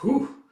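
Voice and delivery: high, rising hoot; relieved whew. Acted. Chief after disarming the boy, high whew. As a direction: let out a loud "whew".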